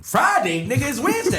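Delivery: high panicked disbelief; shocked high voice. Retold or imitated. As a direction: squeal it in alarm. Shocked at short notice.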